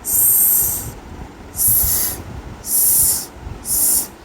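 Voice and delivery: स whispered as a long hiss; just hiss the स with no voice; a breathy, voiceless स hiss. Shown as a demonstration. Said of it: "इसको हम ऐसे बोलेंगे".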